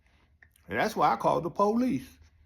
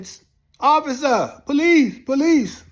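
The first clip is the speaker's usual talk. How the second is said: loud desperate yell for the officer, higher; calling for police; 10 semitones higher, 8 dB louder, slower than usual. Retold or imitated. Inmate yelling for the guard, loud and high. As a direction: shout for help from the cell.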